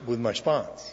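ordinary talk

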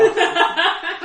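loud, high burst of laughter; laughing fit. Real, not acted. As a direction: crack up loudly, pitched high. Cracks up at elephant tangent.

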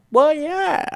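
high, bright surprised agreement; teacher's reply. Retold or imitated. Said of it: "He says".

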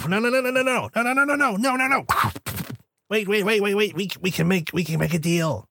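high frantic no no no; panicked pleading. Acted. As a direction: beg fast and high in panic. Villain panics before cube.

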